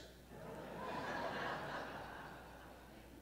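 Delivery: a soft airy laugh at the joke; breathy laugh. Real, not acted. Pastor laughing at a father's joke, breathy laugh. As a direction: laugh quietly under the breath.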